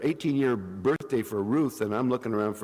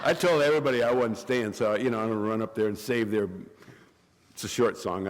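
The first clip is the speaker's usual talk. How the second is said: ordinary talk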